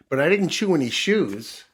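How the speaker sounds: high, loud proud protest; puppy protesting. Acted. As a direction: insist on it like an eager puppy. Puppy boasts to his mother.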